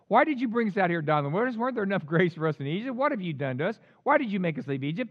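fast run of protesting questions; quick, lightly acted people's complaint; rapid complaint. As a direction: rattle off the grievances. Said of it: "they cried out to the Lord".

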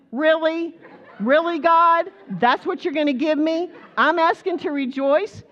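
high, loud disbelieving cry with a laugh; incredulous. Retold or imitated. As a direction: throw your hands up in disbelief. Incredulous at God over bird.

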